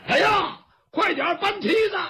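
high, urgent acted shout; full-throated yell. Zhao Hu, stuck below the roof, yells for a ladder, full-throated shout. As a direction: holler for help at the top of your voice. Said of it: "赵虎扯着嗓子就喊".

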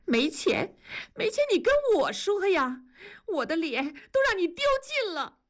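furious through her tears, demanding they move out; tearful anger. Acted. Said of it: "文丽说着就开始流泪". (妻子含泪发怒，逼丈夫搬家)

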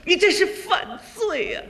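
high-pitched accusation; angry wife. Acted. Wife accuses husband of robbery, angry. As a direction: accuse him in mock outrage. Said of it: "爱人跟我就火了".

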